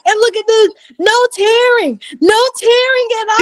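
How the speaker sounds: high, slowed proud boast; the doctor bragging to the room, excited; hyped doctor. Retold or imitated. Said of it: "It's just like".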